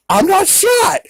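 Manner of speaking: very high, squeaky cartoon voice; Porky Pig. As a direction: imitate a cartoon pig in falsetto. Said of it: "And Porky Pig is like".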